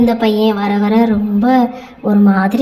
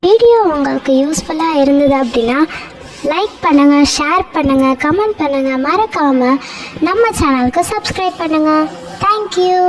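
higher, upbeat subscribe plea; channel outro; 5 semitones higher than usual. Real, not acted. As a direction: pitch likes and shares brightly.

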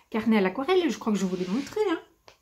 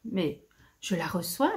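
usual speaking voice

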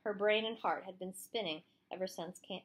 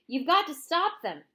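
urging; 8 semitones higher, 7 dB louder than usual. A narrator's reading. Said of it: "urged Catherine".

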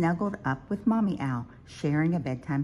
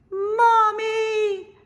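very high, loud, long call; crying out; 8 dB louder than usual. A narrator's reading. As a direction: wail for mother in a little owl's voice. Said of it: "cried Little Owl".

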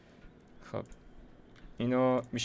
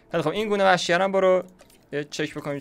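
higher, louder and fast, keen to move on; eager and fast; 7 semitones higher, 5 dB louder than usual. Real, not acted. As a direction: rush it out with eager energy. Eager to check the wild species. (تند، زیر و بلند، مشتاق بررسی گونه وحشی)

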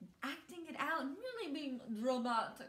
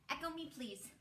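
ordinary talk, nothing marked

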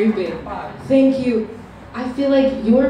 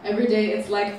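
ordinary talk, nothing marked

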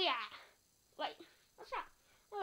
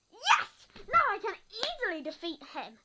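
excited cheer; 3 semitones higher, 6 dB louder than usual. Pretend. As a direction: cheer like you just got superpowers. Becomes Spider-Man.

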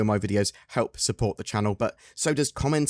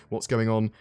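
ordinary talk

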